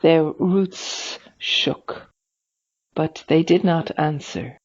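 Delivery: ordinary delivery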